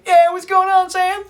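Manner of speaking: high, loud cheery greeting; friendly hail. Retold or imitated. Fan greeting actor Sam at coffee stand, loud and cheery. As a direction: call out like spotting a star. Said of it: "Just like that".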